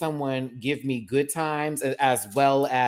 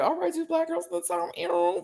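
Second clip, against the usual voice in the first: mock excited voice; 14 semitones higher, 4 dB quieter than usual. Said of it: "I was like".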